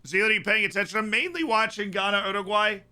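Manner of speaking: high, lively call to a friend; excited call across the room. Real, not acted. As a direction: snap his attention over. Watching several matches, calling to friend.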